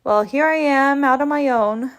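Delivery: usual speaking voice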